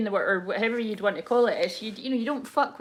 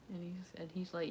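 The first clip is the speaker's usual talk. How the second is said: ordinary talk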